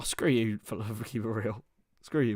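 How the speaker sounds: ordinary talk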